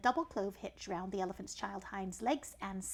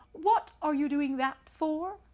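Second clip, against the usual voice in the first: pompous python, a touch higher; snake voice; 6 semitones higher, 8 dB louder, slower than usual. Acted. Python rock snake addressing the elephant's child, lightly voiced. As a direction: lecture the young traveller grandly.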